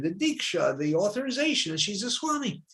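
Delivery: ordinary talk, nothing marked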